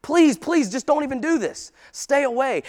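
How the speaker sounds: high, loud begging as Peter; urgent plea. Acted. Peter begging Christ to stay away, urgent pleading. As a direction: plead urgently to be left alone. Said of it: "He drops down. He says, "Please".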